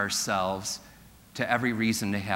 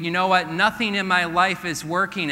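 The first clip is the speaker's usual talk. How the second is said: hopeless complaint; 7 semitones higher, 5 dB louder than usual. Retold or imitated. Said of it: "When you believe".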